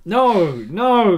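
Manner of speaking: ordinary talk, nothing marked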